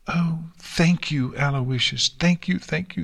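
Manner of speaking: bouncy, high, eager gratitude; excited thanks. A narrator's reading. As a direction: gush out the thanks while bouncing. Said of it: "Nix said with several bounces".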